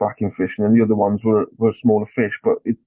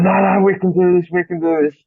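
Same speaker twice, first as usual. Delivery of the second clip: imitating his mate, pitched up and urgent; high encouraging mate voice; 9 semitones higher than usual. To his rattled friend in the storm, a high, eager pep talk. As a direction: pump him up in a raised, eager voice. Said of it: "He's going".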